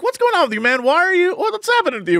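high exasperated questions; incredulous pleading at dad. Retold or imitated. Son baffled by his grumpy dad, high exasperation. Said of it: "And I'm just like".

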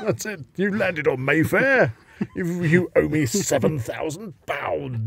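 high, slow, gloating demand. Pretend. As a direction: drag out the payment demand. Acting royal Monopoly game.

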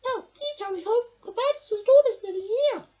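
high, urgent squeaky plea; high mouse warning. A narrator's reading. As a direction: urge him home as a small mouse. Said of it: "Mouse squeaked".

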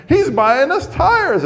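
put-on high, loud, slow child's voice; a small girl announcing big news, pitch way up; excited little girl. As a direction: daughter telling everyone, high and loud child voice.

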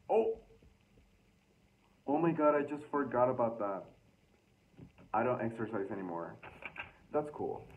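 ordinary talk, nothing marked

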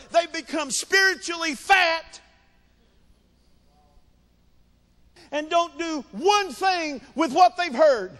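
high, punchy pulpit delivery; full-force preaching. Acted. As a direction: ring each phrase out to the congregation.

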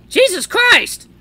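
startled, very high loud shriek; panicked yelp. Acted. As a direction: yelp out as if caught unawares. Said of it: "I yelp out in panic".

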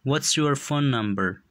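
each word said slowly and distinctly for learners; slow and clear. Shown as a demonstration. Teacher models English phrase for learners, slow and clear. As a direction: model the phrase slowly so students can copy it. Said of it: "به خاطر آموختن تلفظ درستشان".

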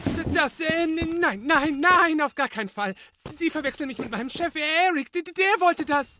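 hoch und laut, stotternd vor Angst; panische Abwehr. Acted. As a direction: fleht schrill und verängstigt, sich herauszureden. Leo wehrt sich in Panik. (Leo verteidigt sich panisch vor der Domina, hoch und laut)

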